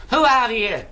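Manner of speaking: sudden loud challenge, voice jumps up; loud demand. Acted. Pricker loudly challenging the stranger, a sudden demand. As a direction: bark the question out suddenly.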